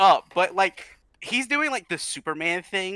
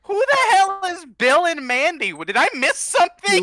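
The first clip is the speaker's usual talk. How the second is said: loud, high-pitched demand; indignant outburst; 13 semitones higher, 7 dB louder than usual. Real, not acted. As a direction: burst out confused and offended. Mock indignant outburst at unknown names.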